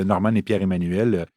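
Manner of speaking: ordinary delivery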